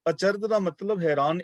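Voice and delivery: ordinary delivery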